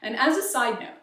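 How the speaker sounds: ordinary talk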